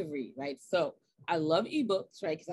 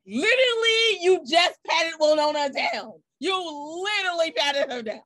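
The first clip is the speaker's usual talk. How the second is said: exasperated laughing shout; 7 semitones higher, 7 dB louder than usual. Real, not acted. Exasperated punchline about airport fan.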